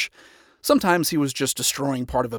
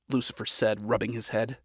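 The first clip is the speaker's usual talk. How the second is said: ordinary delivery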